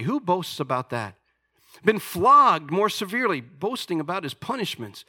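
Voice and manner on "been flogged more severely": high, loud scripture reading; fiery preacher reading scripture; preaching at full force. Acted. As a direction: proclaim Paul's boasts like a challenge. Reads Paul's boasts with force.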